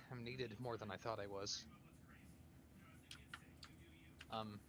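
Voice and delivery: soft, sighing confession; sigh, then confide quietly and hesitantly; hushed, weary in-character voice; subdued, burdened character. Acted. Weary character asking one last question.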